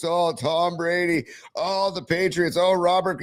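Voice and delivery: mocking high, drawn-out gushing; mock babbling. Host mocking two weeks of Brady babble, high drawn-out gush. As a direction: coo the names slowly, making fun. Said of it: "just babble for two weeks".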